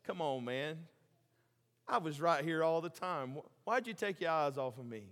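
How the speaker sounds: soft, lightly voiced understanding rebuke; compassionate. Jesus softly asking Peter why he looked away. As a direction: reassure him while chiding. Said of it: "understanding, compassionate Peter".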